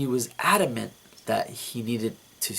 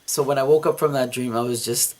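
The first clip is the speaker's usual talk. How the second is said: ordinary talk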